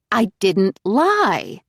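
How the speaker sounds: slow, firm denial; insisting. A narrator's reading. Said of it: "she insists".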